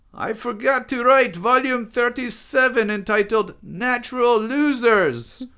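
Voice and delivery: high, loud sputtered outburst; Buffon. A narrator's reading. Buffon hits back at the others, sputtering loudly. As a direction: cry it out indignantly at high pitch. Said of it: "sputtered Buffon".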